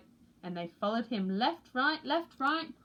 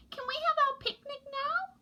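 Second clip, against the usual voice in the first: put-on very high, small child voice; little penguin voice. A narrator's reading. Penguin chick Pog pleads with parents, squeaky high. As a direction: ask like an eager tiny chick. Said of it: "said Pog".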